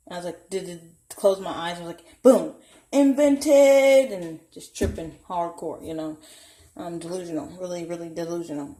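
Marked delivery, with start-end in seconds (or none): 2.2-4.1 loud, high, drawn-out proclamation; big slow mock-magical declaration; re-enacted triumph. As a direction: announce it like a magic trick, stretched out. Acting out her delusional magic.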